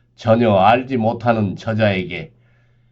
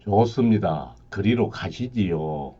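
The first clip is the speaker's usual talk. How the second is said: low somber reaper; 4 semitones lower, 6 dB quieter than usual. Acted.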